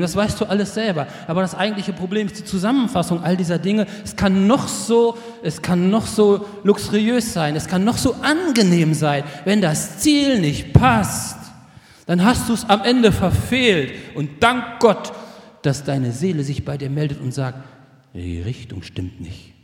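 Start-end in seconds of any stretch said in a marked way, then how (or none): 4.0-15.1 louder, higher, slow emphatic sermon climax; forceful preaching. Real, not acted. Predigt mit voller Kraft. 18.1-19.5 low, soft, slow warning; quiet inner voice. Acted. Seele meldet sich leise zu Wort.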